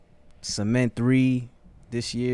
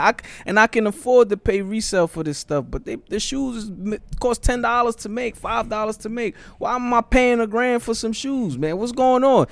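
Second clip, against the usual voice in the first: higher-pitched, exasperated disbelief; indignant rant; 10 semitones higher than usual. Real, not acted. Venting to friends about overpriced shoes, indignant. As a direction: protest it in a high, incredulous voice. Ranting about resale prices.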